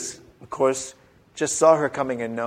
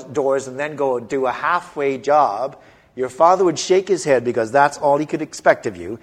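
normal talking voice

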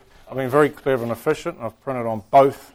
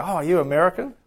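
higher, rising surprised question; curious stranger; 6 semitones higher, faster than usual. Acted. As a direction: ask like a stranger who just noticed an accent. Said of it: "someone hears your accent and go".

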